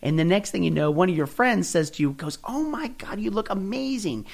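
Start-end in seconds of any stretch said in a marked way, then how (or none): 2.4-4.2 high, soft gushing compliment; gushing friend. Retold or imitated. Friend sees the skinny jeans and gushes, high and soft. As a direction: coo it like an impressed friend.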